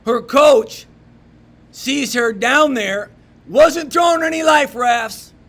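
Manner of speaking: rally the room with rising force; fiery preaching. Acted.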